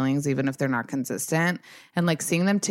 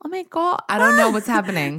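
slightly higher, lightly put-on British voice; attempted English accent; 6 semitones higher than usual. Retelling the ex's reaction in a mock English accent. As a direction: try for a posh English lilt. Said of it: "I'm British".

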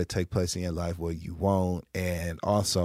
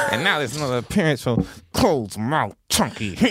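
TV detective impression; 3 semitones higher than usual. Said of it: "He'd be like".

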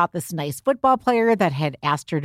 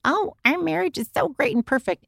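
mock gushing voice, pitched much higher; mock boasting; 10 semitones higher than usual. Mother mocks boastful couples, pitched much higher in mock gush. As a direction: sneer at couples who claim a perfect marriage.

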